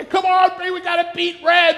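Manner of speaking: loud, high rallying yell; cheering shout. Retold or imitated. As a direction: urge the coaster on to win. Racing roller coaster cheering.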